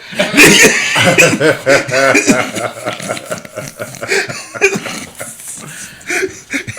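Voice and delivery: high loud cackling laugh; long loud laugh. Real, not acted. Cracking up at his own joke to friends, loud cackle. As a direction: burst out laughing.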